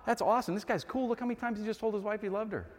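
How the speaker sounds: high, light approving voice; mock-impressed reader, speeding up at the end; naive admiration. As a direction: marvel innocently. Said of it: "you'd be like".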